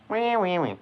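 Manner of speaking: squeaky high-pitched wees. Acted. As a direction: squeal like a little piglet. Playful squeaky noises on stream.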